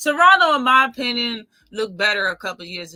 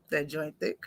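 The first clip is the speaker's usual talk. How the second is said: laughing; 7 semitones lower, 8 dB quieter than usual. Real, not acted. Laughs until it cracks.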